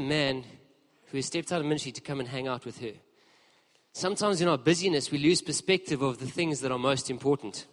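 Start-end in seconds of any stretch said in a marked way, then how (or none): none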